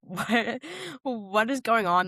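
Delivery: ordinary delivery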